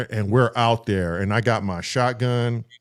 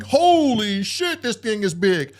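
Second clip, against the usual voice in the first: high, slow retold gasp of shock; stretched-out high holy shit; awed shock; 8 semitones higher, slower than usual. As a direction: act out seeing a huge bird up close. Realizes it is an ostrich.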